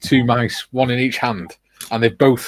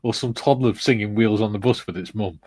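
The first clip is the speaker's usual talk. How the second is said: ordinary talk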